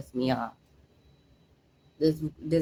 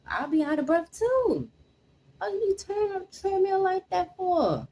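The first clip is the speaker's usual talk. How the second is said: outraged disbelief. Real, not acted. Outraged at father on video.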